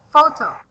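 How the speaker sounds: "photo" with a strong, loud PHO; stress on first syllable. Shown as a demonstration. Teacher explaining a confusing word to a student, first-syllable stress on "photo". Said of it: "So the stress word is at the first syllables".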